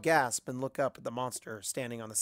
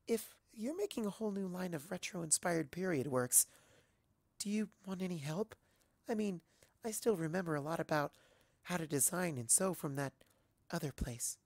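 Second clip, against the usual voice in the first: soft, higher, shy offer; timid soft voice; 5 semitones higher, 8 dB quieter than usual. Acted. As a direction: offer help almost whispering. Fluttershy shyly offers help.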